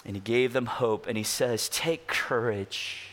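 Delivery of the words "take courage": gentle comfort. Retold or imitated. Jesus comforting quoted slowly.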